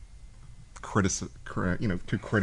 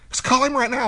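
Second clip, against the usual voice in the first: much higher, louder pushy demand; mock urging shout; 6 dB louder than usual. Matt shouting at him to call the boss right now.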